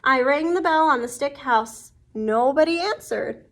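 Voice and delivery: ordinary delivery, nothing marked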